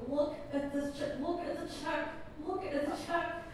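high, hushed, choked-up squeal; acting out crying in a small high voice; retold tearful disbelief, kept quiet and high; mock tearful. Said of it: "And I'm crying".

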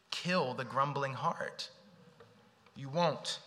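normal talking voice

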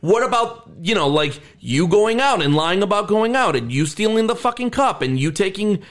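high, fast heated rant; heated retort. Retold or imitated. Daughter turning on her father, high heated rant. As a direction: fire the hypocrisy back at him.

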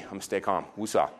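calming self. Real, not acted. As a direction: talk yourself down quietly. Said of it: "this is the new me".

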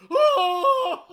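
very high, loud howl; mock howl. Joking that actor prepares for every movie, high howl. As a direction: let out a long shrieked "oh".

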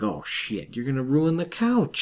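high, fast fretting over the couch; panicked fussing. Retold or imitated. Host voicing someone worried about the couch, high frantic fretting. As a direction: fret about the furniture in a rush.

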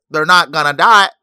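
caricatured president, loud then easing off; SNL president character. Pretend. Host talking about Nintendo's plans, mock SNL president impression. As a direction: repeat it like a sketch-show president catchphrase. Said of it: "That's a S N L character".

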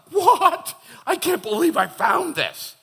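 high, loud, laughing excitement; mock amazed discovery. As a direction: burst out in gleeful disbelief. Acts out pastor's thrill at discovery.

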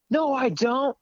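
high-pitched mock denial; put-on high voice, arguing back; imitating bartender's denial. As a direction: mimic her contradicting, voice way up. Acting out bartender denying she has card.

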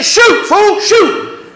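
very high urging yell; urgent shout. Retold or imitated. Gang urges the frozen kid to shoot, urgent shout.

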